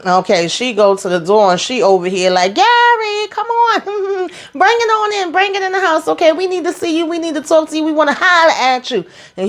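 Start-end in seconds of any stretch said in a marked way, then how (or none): 2.5-9.0 high sing-song call that settles down. Retold or imitated. Danny calling Gary into the house, high teasing call. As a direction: call him in from the door, high then easing.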